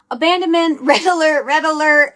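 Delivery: mock alarm. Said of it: "my nervous system would go like".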